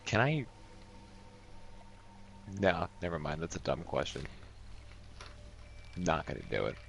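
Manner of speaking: ordinary talk, nothing marked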